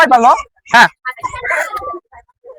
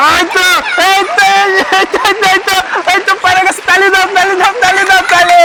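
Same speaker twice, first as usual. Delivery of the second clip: rising higher with each call, then clapping; excited cheering; 6 semitones higher than usual. Real, not acted. Teacher cheers a girl on and calls for claps, excited and high.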